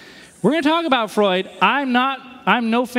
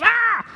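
mock yell. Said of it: "and he yells".

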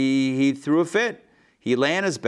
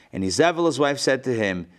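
usual speaking voice

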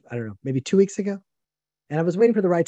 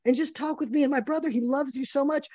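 mock reasonable plea; 8 semitones higher than usual. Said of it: "So I said".

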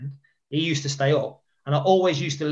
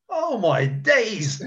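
slow, drawn-out, higher disbelief; stunned oh my days; 6 semitones higher, much slower than usual. Retold or imitated. Seeing his old mate smile, slow amazed disbelief. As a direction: drag it out in shocked wonder. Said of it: "and I was like".

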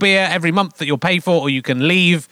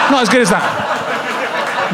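laughing; 4 semitones higher, much slower than usual. Real, not acted. Laughing at the book.

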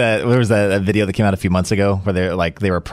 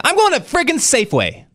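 indignant declaration; 13 semitones higher than usual. Retold or imitated. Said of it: "this is what I said again".

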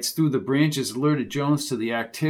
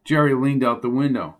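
usual speaking voice

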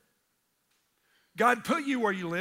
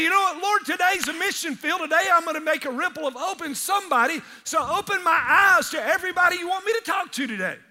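high, loud, earnest pleading; fervent prayer; 6 semitones higher, 5 dB louder than usual. Retold or imitated. Preacher models a morning prayer, loud fervent plea. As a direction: pray it with full fire.

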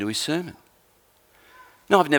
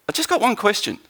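high-pitched detective afterthought; casually spring one last question, pitched up; Columbo; 7 semitones higher than usual. Acted. Detective turns back to the suspect after walking away, Columbo impression. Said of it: "And Columbo would go".

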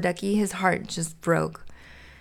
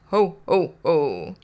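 Santa Claus; 2 semitones lower, slower than usual. Pretend. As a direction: pretend to be Father Christmas. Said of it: "You play the part of Santa Claus".

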